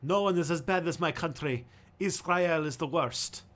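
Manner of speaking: a put-on Hebrew accent, done badly on purpose; hint of a comic Israeli Hebrew accent; mock Hebrew accent. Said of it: "that was the worst Hebrew accent I've ever done".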